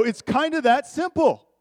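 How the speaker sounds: full-force preaching. Acted. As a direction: preach it out to the whole room.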